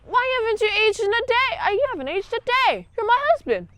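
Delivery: high, put-on old lady's plea; pleading old wife. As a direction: beg him like a wronged old wife. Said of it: "she's like".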